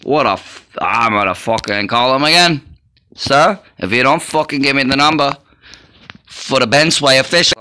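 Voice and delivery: loud, cursing threats down the phone; angry yelling. Acted. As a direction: demand the number and yell it. Calls back and yells at official.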